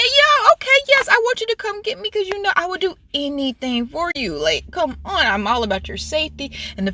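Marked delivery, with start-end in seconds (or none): 0.0-4.4 high-pitched pretend reply; imagined eager plea. Voicing what her friend should have said, eager and high. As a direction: say it high, as an eager mock acceptance.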